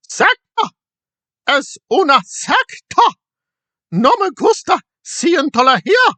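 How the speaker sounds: high, slow put-on Spanish voice; mock Spanish quote. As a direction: ham it up in Spanish. Quoting in Spanish.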